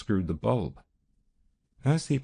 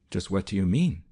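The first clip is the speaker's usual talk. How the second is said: plain reading voice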